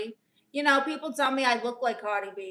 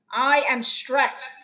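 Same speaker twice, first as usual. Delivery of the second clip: drawn-out stressed; 2 semitones higher, 8 dB louder, much slower than usual. Real, not acted. Dramatic complaint about WrestleMania.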